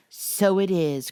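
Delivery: loud, slow, eager exclamation; eager cry. Acted. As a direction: Laurie jumps in eagerly. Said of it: "cried Laurie".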